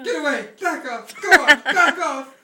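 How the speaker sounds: loud frantic shouting at a dinosaur; panicked shouts, laughing through them. Acted. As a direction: yell to scare it off. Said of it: "Regis was shouting at the top of his lungs".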